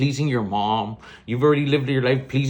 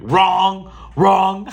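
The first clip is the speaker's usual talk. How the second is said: loud, higher game-show style rejection; emphatic raised-voice correction; loud mock verdict; 6 semitones higher, 6 dB louder than usual. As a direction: call it out like a buzzer. Calling out dad's advice as wrong.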